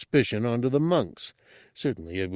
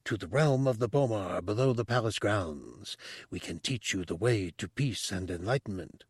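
soft, hushed and pressing; urgent whisper; 6 dB quieter than usual. Acted. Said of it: "the monk whispered urgently".

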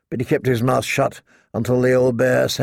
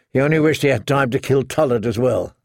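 plain reading voice

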